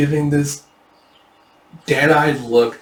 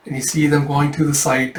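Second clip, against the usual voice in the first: plain reading voice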